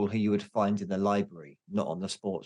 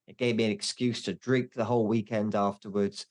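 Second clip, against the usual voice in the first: ordinary delivery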